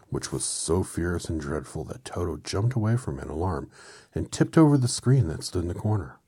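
plain reading voice

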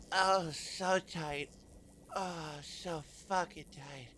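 very soft moaning; barely voiced pleasure; breathy moan. Moaning quietly.

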